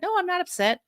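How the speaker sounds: high, light, controlled denial; pretending not to be upset. Mother answering her son's push, pretending not to be upset. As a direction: keep it high and insist you are fine. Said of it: "doing that voice that you pretend".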